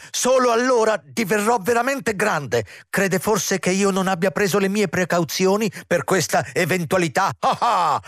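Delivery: high-pitched manic boasting; shrill, triumphant rant ending in "Ah, ah!"; hysterical triumph. A narrator's reading. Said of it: "trionfò isterico l'intendente".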